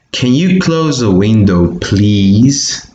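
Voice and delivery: "please" lifted at the end as a question; question intonation. Shown as a demonstration. Teacher modelling a question for learners, rising question intonation. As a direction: read "Can you close the window, please" with rising tune. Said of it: "there's a rising intonation".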